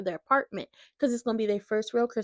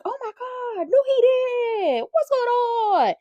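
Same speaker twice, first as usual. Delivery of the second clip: much higher, slow, loud imitated gasp of disbelief; mock shock; 14 semitones higher, 6 dB louder, much slower than usual. Martin hearing about Lulu's escape, hammed-up shocked disbelief. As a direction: stretch out the words like a hammed-up soap shock. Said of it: "And Martin over here is surprised".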